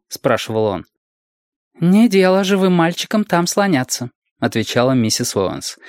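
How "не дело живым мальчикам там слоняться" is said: high-pitched motherly reproof; higher-voiced woman warning a child; scolding mother figure. A narrator's reading. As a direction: forbid it like a fussing guardian. Mrs Owens forbids the boy.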